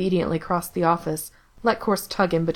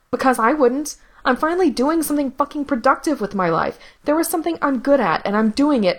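higher, louder heated defence; Gerard defensive; 7 semitones higher, 3 dB louder than usual. Acted. Gerard defends his work to his brother, higher and heated. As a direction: push back hard, voice climbing.

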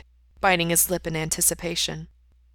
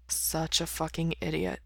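muttered; 8 dB quieter than usual. A narrator's reading. Sleepy lover grumbling at partner, muttered. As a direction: mutter it under your breath. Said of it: "he mutters".

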